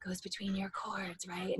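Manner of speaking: airy whisper, very soft; demonstrative whisper. Shown as a demonstration. Teacher showing air between the cords, whispered. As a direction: drop into a whisper to show it.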